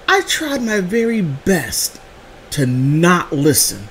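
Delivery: slow, drawn-out words. Real, not acted. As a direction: stretch it out with irony. Mock reluctance to overhear.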